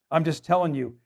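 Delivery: usual speaking voice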